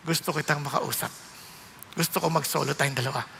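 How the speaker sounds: low, soft and tender, voicing the Lord; a low, hushed divine voice calling quietly; gentle voice of God. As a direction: speak as a loving God inviting closeness. God speaks in retold story.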